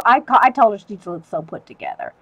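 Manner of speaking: usual speaking voice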